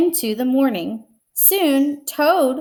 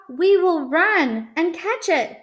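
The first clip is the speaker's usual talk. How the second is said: pitched up and pressing; urgent Frog; 7 semitones higher, 3 dB louder than usual. Acted. Frog urging Toad to chase the list, pitched-up pressing voice. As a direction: push him to run. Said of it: "said Frog".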